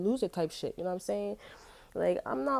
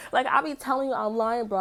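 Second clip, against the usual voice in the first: emphatic, laughing; 5 semitones higher, 5 dB louder than usual. Real, not acted.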